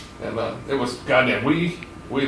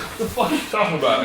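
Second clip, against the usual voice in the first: higher, quick, incredulous reaction; mock-disbelieving customer; 6 semitones higher, faster than usual. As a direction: act baffled at the crazy request. Customer shocked at request.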